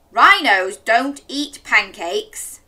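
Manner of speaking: lightly voiced firm dad. A narrator's reading. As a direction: lay down the facts slowly. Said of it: "said Dad".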